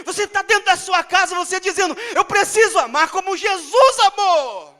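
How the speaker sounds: loud, high fervent sermon voice; preaching at full force. Acted. Pastor urging the congregation to love, loud and fervent. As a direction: preach it out to the whole church.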